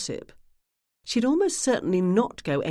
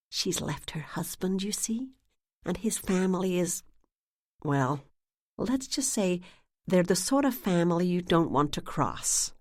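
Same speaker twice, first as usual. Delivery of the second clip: put-on soft, secretive whisper in New England accent; confiding hush; 2 semitones higher, 4 dB quieter than usual. A narrator's reading. Narrator whispers gossip to the clerk, hushed. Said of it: "leaned over the desk".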